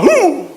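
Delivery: high-pitched, loud hooting yell; imitated odd kiai. Preacher recalling his own taekwondo shout, high odd yell. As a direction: let out a comically different taekwondo cry. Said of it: "Yo gritaba".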